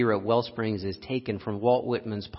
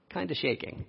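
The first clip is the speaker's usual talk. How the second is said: ordinary talk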